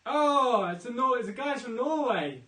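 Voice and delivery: loud, high burst of delight; excited recognition. Real, not acted. Host spots the guy from Norway, excited. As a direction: call it out loud as you spot a friend.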